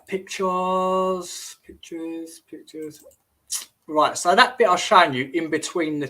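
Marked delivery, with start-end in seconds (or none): 0.1-3.0 drawn-out searching. Real, not acted. Streamer hunting for pictures to show viewers, drawn-out and fading. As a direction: stretch the first word long, then fade.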